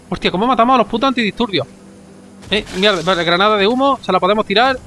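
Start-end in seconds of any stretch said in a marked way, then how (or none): none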